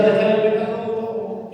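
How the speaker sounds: voz de predicador, más aguda y cantada; predicación ferviente. Acted. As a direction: predica con fervor creciente. Predicación fervorosa. (predicador a la congregación, con fervor cantado)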